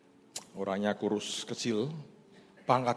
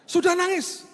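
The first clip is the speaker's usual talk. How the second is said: much higher, a cry of wonder; amazed; 5 dB louder than usual. Real, not acted. Pastor telling the church the officer already cried, amazed. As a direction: marvel at how fast he cried.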